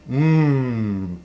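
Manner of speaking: long, falling "mmm" of enjoyment; savouring hum. Acted. Tasting the pie on camera, long savouring hum. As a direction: hum slowly over a tasty bite.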